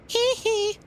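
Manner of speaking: high squealed [name]-style hee hee. Acted. Streamer tossing off a pop-star squeal, high and sharp. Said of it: "I was always a [name] kind of a guy".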